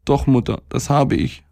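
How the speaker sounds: put-on high little-boy voice, mildly pitiful; tearful boy. A narrator's reading. Sick boy confessing to his mother, mildly tearful. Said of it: "sagte er und weinte gottsjämmerlich".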